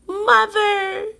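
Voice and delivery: high, tiny, far-off squeak; a lost baby bat calling for mother; squeaky baby bat. A narrator's reading. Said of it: "Stellaluna squeaked".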